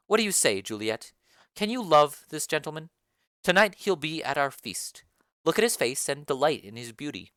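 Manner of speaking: low, commanding lady of the house; Lady Capulet. Acted. Lady Capulet calls the nurse for her daughter, low and commanding.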